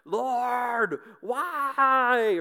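high, loud, drawn-out cry to heaven; freaking-out wail. Acted. Jonah complaining to the Lord about the heat, freaking out. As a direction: wail it in melodramatic despair. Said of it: "he's freaking out".

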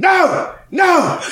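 high shouted no, no; mock shout. Sketch actor shouting no at the scene partner. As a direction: yell it up high as the character.

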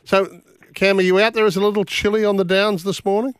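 calling out loud and high; hailing a distant guest; calling from far away. Real, not acted. Host calls out to remote guest.

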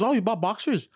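high, prying mom imitation; mother's voice. Son mocking his mom asking about boxers, mom voice.

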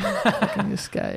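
hint of an old Italian man; chummy old Italian neighborhood guy, lightly put on; old Italian man accent. Old guy vouching for Joey, light old Italian accent. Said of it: "like an old Italian man accent".

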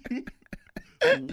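loud high cackle, real; burst of high laughter. Real, not acted. Cracking up at his own story.

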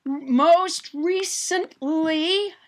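slow careful words. Pretend. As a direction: balance on each word. Pretend balancing on tightrope.

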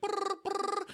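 high silly brr-brr noise; mock thinking noise. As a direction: buzz like a computing brain. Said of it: "she's like".

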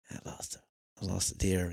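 whispered, then soft; whispered dismay at losing the deer. Retold or imitated.

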